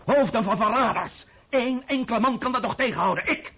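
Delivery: high, loud and fast, rising passion; fervent. Acted. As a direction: build into a fervent boast. Fervent speech about the guillotine.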